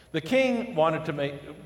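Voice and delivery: ordinary talk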